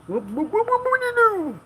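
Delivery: high, swooping silly whoops; playful whooping. Pretend. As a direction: let the whoops climb way up. Playful whooping at departing boat.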